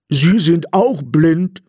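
high, slow, astonished question; puzzled high robber voice; confused. A narrator's reading. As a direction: ask baffled, stretching the words. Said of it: "fragte der blinde Räuber verwirrt".

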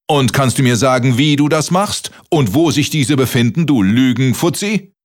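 high-pitched, pressing interrogation; irate giant. A narrator's reading. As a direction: grill the little liar indignantly.

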